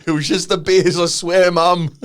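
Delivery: loud, high-pitched playful excuse; mock protest to mum. Host jokes to his mum about a night out, mock protesting. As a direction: plead innocence to mum, hammed up and loud. Jokingly pleading innocence to mum.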